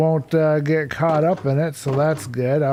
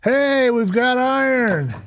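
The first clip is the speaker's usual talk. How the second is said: excited; 9 semitones higher, slower than usual. Real, not acted. Finally finds iron.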